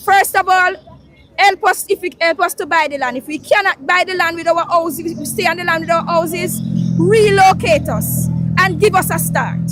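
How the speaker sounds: usual speaking voice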